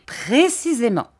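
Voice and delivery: mock delighted cry, understated. A narrator's reading. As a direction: cry it out, pleased. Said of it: "cria monsieur Dick".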